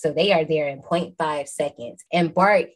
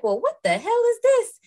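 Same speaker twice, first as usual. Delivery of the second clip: much higher, outraged shriek of disbelief; shocked old lady. Retold or imitated. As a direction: Norma squawking at what she finds. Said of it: "and she like".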